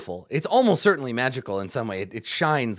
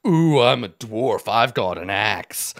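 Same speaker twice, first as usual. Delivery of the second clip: slow, mocking dwarf impression, starting loud; mocking dwarf imitation; 4 semitones lower, 6 dB louder, slower than usual. Goblin boss mimics his dwarven prisoner, mocking. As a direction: drag it out to ridicule the dwarf. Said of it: "He came down here all".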